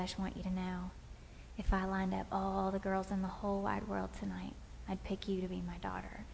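soft, hushed fatherly words; tender whisper. Retold or imitated. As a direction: say it softly like a proud dad. Dad's tender words before prom.

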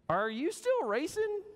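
high curious question, lightly voiced; a touch of puzzled man asking; voicing the man. Preacher voicing the man quizzing the dog, high and curious.

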